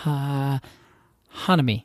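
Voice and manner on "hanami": hanami as HA-na-mi, lower and careful; corrected Japanese stress. Shown as a demonstration. Learner trying the corrected word, hanami stressed on HA. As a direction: try hanami with HA stressed, falling away. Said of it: "emphasis either on the first or the second".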